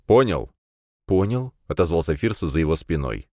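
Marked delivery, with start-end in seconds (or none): 0.1-0.5 louder, higher, falling challenge; stern demand. Acted. Boss demands confirmation of threat. 1.1-1.5 higher, rising, less forceful answer; compliant reply. Acted. Young man meekly confirms.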